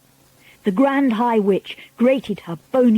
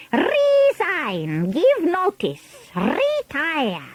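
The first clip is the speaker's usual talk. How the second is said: put-on higher, accented, imperious witch voice; Grand High Witch; 8 semitones higher than usual. A narrator's reading. Witch leader demanding silence from her followers, imperious voice. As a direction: give orders as a commanding villain.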